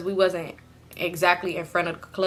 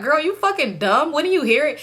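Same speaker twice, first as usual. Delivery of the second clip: higher-pitched mock incredulity; imagined disbelief; 6 semitones higher than usual. What she felt he was thinking of her, mock disbelief. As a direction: voice his unspoken scorn, pitched up. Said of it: "he looking at me like".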